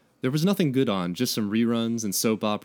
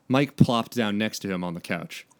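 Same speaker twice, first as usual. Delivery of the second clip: normal talking voice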